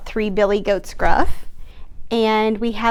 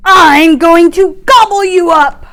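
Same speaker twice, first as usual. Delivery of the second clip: big fish threat; 7 semitones higher, 14 dB louder than usual. Acted.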